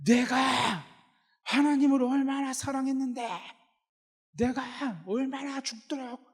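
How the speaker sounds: high, strained cry of bitter protest; hammed-up defiant complaint, neck veins bulging; indignant Elijah. Elijah protesting to God that he alone survived, straining high in indignant appeal. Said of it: "목에 핏대를 세우고 대드는".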